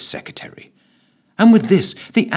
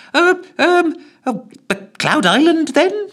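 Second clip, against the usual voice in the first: very high, hesitant last guess; minister's desperate final guess; secretary; 5 dB louder than usual. Acted. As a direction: try one more time, nervously.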